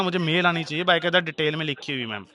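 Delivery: ordinary talk, nothing marked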